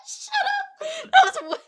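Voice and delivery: words squeezed out through high laughter; giggling protest; laughing. Real, not acted. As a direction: talk while still cracking up. Laughing at own bad French.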